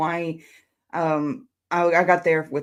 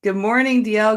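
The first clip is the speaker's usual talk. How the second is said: bright greeting; 4 semitones higher, 4 dB louder than usual. Real, not acted. Cheerful greeting to the host.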